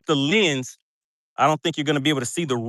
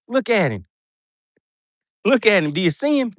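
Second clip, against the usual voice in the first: high, proud look at him; excited; 6 semitones higher than usual. Real, not acted. Angler showing viewers his catch, excited. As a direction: show off the fish.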